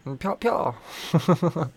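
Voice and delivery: high, drawn-out pyaw-pyaw gun noises; imitated gunshots. Retelling a chase out of a gun store, playful gunshot noises. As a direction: mimic cartoon gunfire with your mouth.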